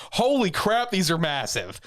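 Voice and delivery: gasping awe at the size of it; amazed retold exclamation. Teller voicing his shock at giant wings, amazed.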